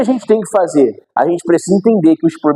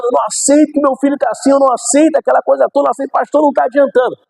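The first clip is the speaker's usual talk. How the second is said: much higher, pressing plea of a desperate mother; mãe orando aflita; 8 semitones higher, faster than usual. Retold or imitated. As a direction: insist you won't accept it, pitched up high. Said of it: "Aí a mãe orando".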